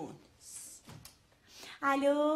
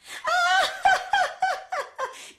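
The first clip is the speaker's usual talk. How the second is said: laugh; 14 semitones higher than usual. Acted. Laughs at the angry caller.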